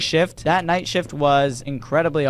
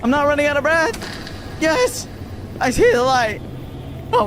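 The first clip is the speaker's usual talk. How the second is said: excited; 10 semitones higher than usual. Real, not acted. Escaping the chase, excited.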